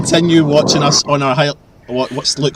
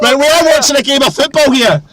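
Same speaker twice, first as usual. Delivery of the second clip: high-pitched exasperated outburst; exasperated call; 9 semitones higher than usual. Real, not acted. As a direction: call out high over the chatter. Shouting at friends over match.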